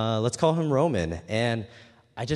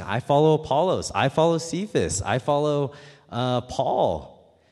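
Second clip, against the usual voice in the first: higher, louder chant of rival followers; mock boasting factions; 6 semitones higher, 5 dB louder than usual. Preacher voicing quarreling Corinthian church members, mock proud. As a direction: proudly declare your teacher, one after another.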